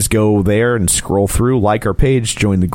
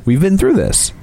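ordinary talk, nothing marked

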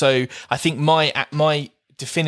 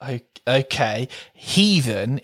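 usual speaking voice

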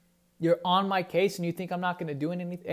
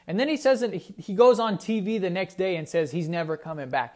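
usual speaking voice

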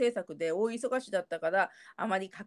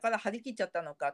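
ordinary talk, nothing marked

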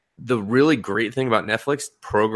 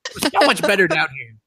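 higher-pitched put-on whine of protest; mock complaint; 9 semitones higher than usual. Rock dweller protesting to whoever disturbed them, mock complaint. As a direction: playfully wail in protest.

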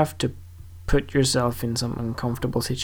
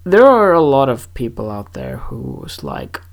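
ordinary talk, nothing marked

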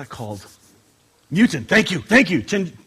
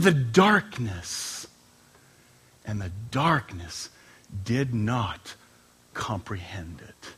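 scripture reading, fading to a whisper; 6 semitones lower, much slower than usual. Acted. Pastor reading John, solemn, fading to a whisper. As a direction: let the darkness swallow the words.

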